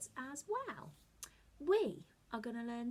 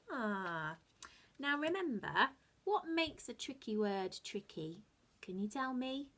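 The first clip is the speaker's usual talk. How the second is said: ordinary talk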